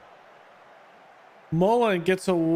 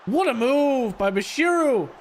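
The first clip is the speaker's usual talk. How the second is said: excited; 7 semitones higher than usual. Real, not acted. Teammate's great move.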